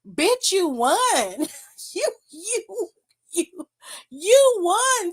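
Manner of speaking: high, laughing, cracking with disbelief. Real, not acted. Vlogger laughing at a wrestler who cried after winning, high-pitched disbelief.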